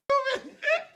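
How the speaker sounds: sudden high burst of laughter. Real, not acted. Reacting to the clip.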